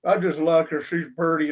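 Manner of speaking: lightly voiced dopey big guy, a little low; big dumb guy. Big guys smitten by her voice, mild dumb-guy impression. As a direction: play a big lug smitten and dim. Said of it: "great big dumb guy".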